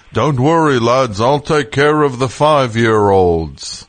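slow, drawn-out mock Beatle voice; [name]. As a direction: stretch it out like a goofy children's entertainer. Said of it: "That's [name]".

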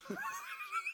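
shrieking laugh. Real, not acted. Delighted reaction.

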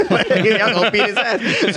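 high, laughing remark; laughing aside. Real, not acted. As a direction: say it through a chuckle. Laughing aside about the rappers.

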